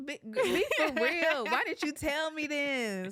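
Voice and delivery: high, slow mock exasperation; exasperated disbelief. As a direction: drag it out like you can't believe her. Retorting to mom's dream claim.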